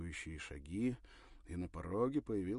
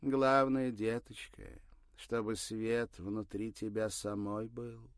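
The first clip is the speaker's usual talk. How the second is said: put-on old woman voice; 4 semitones higher, 8 dB louder, slower than usual.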